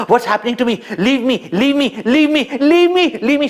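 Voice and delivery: a demoness begging, building to a shriek; climbing desperate cries of leave me; panicked pleading. Acted. Demoness being sucked dry.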